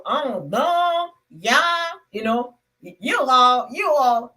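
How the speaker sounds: a light Southern drawl, words stretched; Southern American accent. Retold or imitated. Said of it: "The Southern people, they stretch their word".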